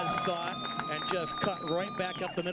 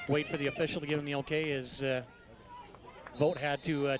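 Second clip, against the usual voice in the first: usual speaking voice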